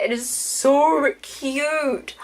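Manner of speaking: slow, drawn-out gushing; gushing delight. Real, not acted. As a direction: stretch out SO in delight. Gushing over cute packaging seal.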